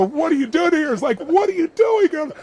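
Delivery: mock-furious, can't believe her; exasperated outrage. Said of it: "wanted to grab her by the throat and just go".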